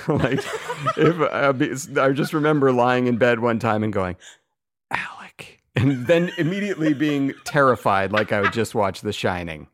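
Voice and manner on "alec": creepy whispered name. Retold or imitated. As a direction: whisper your own name in the dark. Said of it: "If you whisper your own name".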